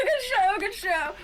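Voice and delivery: laughing praise. Acted. Dryad laughing in delight.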